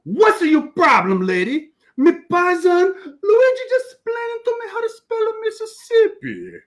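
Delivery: put-on indignant Italian accent, high and loud; hammed-up offended Italian. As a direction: snap back at the lady like Giuseppe. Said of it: "Giuseppe looks at her and goes".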